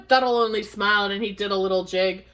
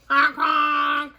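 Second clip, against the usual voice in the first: raised, drawn-out honking; goose honk; 4 semitones higher, 3 dB louder than usual. Acted. Reader demonstrates the goose honk.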